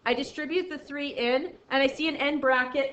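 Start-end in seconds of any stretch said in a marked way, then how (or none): none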